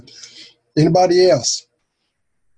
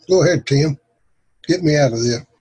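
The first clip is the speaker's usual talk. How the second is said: ordinary talk, nothing marked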